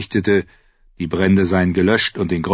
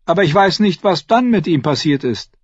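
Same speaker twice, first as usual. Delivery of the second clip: higher, lightly voiced dwarf lord; Tyrion's voice; 11 semitones higher, 5 dB louder than usual. Tyrion answers the Hound about the horse, higher voice. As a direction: pitch up into Tyrion's quick voice.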